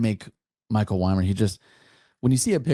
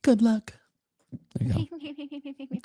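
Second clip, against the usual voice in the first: much higher mock copy of her voice; mimicking host; much slower than usual. Teasing the host by imitating her, high and slow. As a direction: parrot her back, teasing. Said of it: "I got your voice now".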